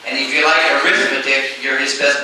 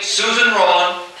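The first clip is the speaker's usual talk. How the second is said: ordinary delivery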